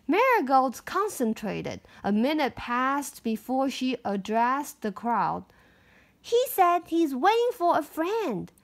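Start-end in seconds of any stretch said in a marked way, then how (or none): 6.2-8.5 put-on little girl voice. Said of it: "she announced".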